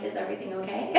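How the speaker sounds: very soft, low timid question. Retold or imitated. Comic asking firemen softly through her chained door.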